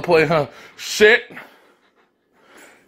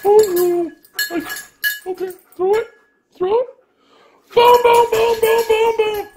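very high, playful pup chant; be the dog begging for the throw. Pretend. Voicing the dog.

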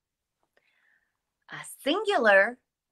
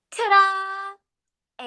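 high, loud ta-da; excited reveal; 10 semitones higher, 7 dB louder than usual. Acted. Teacher unveils the egg picture with a bright ta-da. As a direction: present it like a magic trick.

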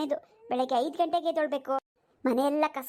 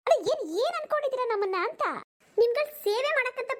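higher-pitched, indignant challenge; indignant outburst; 6 semitones higher than usual. Acted. Wife fed up with serving her husband's household, indignant. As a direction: throw the questions at him, pitched up.

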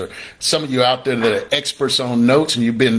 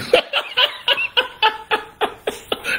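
mock mocking laugh; 4 dB quieter than usual. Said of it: "people that's still looking at me going".

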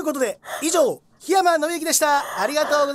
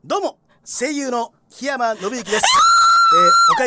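loud greeting; 6 semitones higher, 6 dB louder than usual. Acted. Voice actor's big greeting.

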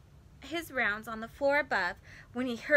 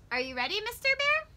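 high, quick playful aside to a teddy bear; playful question to bear; 10 semitones higher, faster than usual. Pretend. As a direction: tease the toy bear brightly. Reader talks to toy bear.

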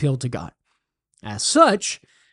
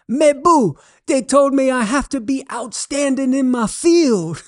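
much higher Cajun voice delivering the punchline; 9 semitones higher than usual. Acted. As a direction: deliver the pun high and proud in a Cajun accent. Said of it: "And Thibodeau says".

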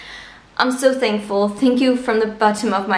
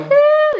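much higher, louder joyful cheer; loud excited woohoo; 5 dB louder than usual. Real, not acted. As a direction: let out a big happy woohoo.

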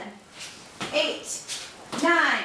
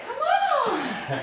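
laughing; 10 semitones higher than usual. Real, not acted. Teasing, laughing.

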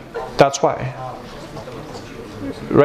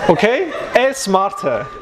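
laughing; 3 semitones higher, slower than usual. Real, not acted. Laughing through the joke.

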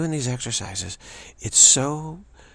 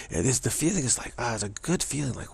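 normal talking voice

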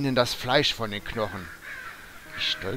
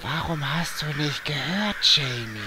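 sinister granny; 4 semitones higher than usual. Acted. Murderous grandmother confronts Jamie.

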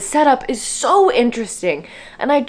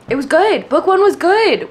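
much higher, louder insistence; higher, louder emphatic pleading; 9 semitones higher, 4 dB louder than usual. Real, not acted. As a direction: insist with rising exasperation. Pleading with author Brandon.

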